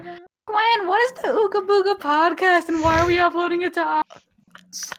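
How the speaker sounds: high, slow mock-mom voice; exaggerated squeaky mom questioning; imitated mom. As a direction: put on a high-pitched puzzled mother. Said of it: "my mom looking and being like".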